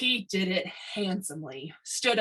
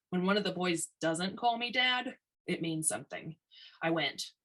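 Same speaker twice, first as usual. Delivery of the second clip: ordinary delivery, nothing marked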